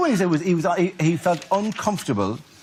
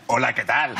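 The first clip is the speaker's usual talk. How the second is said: Spanish accent; 6 semitones lower than usual. Shown as a demonstration. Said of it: "my Spanish accent".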